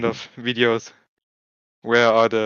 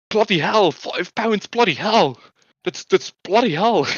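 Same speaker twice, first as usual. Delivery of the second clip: mock Scouse girl complaining; 8 semitones higher than usual. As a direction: play an eight-year-old outraged at ice cream prices. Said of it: "she's fully complaining about the".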